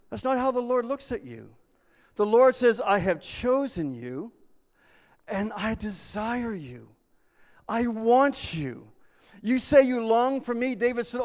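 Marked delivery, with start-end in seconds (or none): 2.8-8.9 slow, earnest longing; tender longing. Acted. Preacher voicing God to people, slow and tender. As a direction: linger on each word, yearning.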